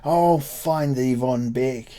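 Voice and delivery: slow, lightly voiced villain's threat; brigand threat. A narrator's reading. Pursuer threatening von Beck, a hint of a stage villain. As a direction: promise revenge like a stage brigand. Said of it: "like a brigand".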